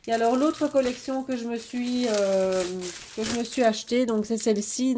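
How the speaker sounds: ordinary talk, nothing marked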